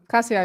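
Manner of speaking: Kasia with a plain s sound; everyday pronunciation. Shown as a demonstration. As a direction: say Kasia as KAH-see-ah. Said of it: "The first one".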